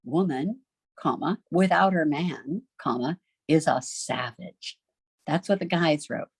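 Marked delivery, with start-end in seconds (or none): 0.0-4.8 a pause after "Woman" and after "man", "without" lifted; men's punctuation. Shown as a demonstration. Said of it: "all the men wrote".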